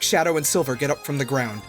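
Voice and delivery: ordinary delivery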